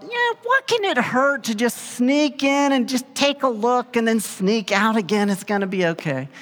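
high, light voice talking yourself into it; mock-innocent what could go wrong; carefree rationalizing. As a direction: buddies deciding to sneak into the plant. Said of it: "We thought".

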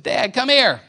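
put-on high, loud call of a small boy across the flat. Little son calls his dad from bed, loud and high. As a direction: call Dad from the bedroom like a five-year-old. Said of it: "Sam, called, said".

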